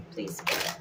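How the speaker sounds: whispered plea; whispering. Real, not acted. Pleading quietly to spirit.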